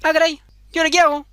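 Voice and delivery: put-on high, fast fretting girl; panicked girl. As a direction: fret it out quickly in a high voice.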